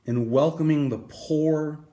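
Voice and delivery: plain reading voice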